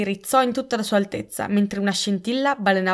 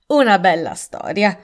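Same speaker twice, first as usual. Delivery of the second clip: a louder, scoffing jab; sarcastic; 5 dB louder than usual. A narrator's reading. The colonel mocking Miss Marple's explanation, louder and sarcastic. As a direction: dismiss her claim with a sneer. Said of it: "disse il colonnello con sarcasmo".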